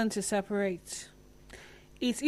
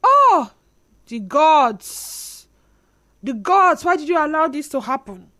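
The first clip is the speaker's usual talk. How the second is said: Nollywood melodrama; 5 semitones higher, 9 dB louder than usual. Retold or imitated. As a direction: wail it like a Nollywood film. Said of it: "there's one soundtrack. Say".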